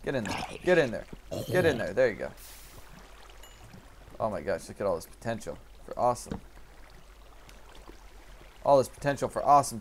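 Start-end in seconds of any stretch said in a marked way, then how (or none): none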